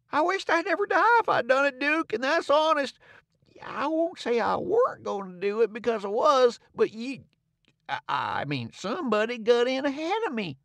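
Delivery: lightly voiced old conman, high and pleading; king bargaining with the Duke; wheedling old king. A narrator's reading. King figure voice.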